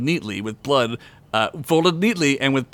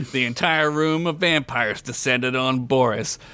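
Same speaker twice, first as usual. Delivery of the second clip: ordinary delivery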